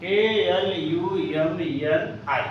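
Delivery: slow, letter by letter; spelling alumni slowly, one letter at a time. Shown as a demonstration.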